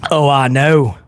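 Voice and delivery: ordinary delivery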